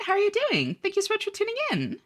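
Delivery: very high, sing-out welcome; high-pitched greeting. Real, not acted. Streamer greets a newly arrived viewer.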